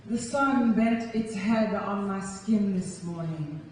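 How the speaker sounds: slow, tender poetry recital; poem reading. Acted. Poet opening a love poem, slow recital. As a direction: ease into the poem slowly.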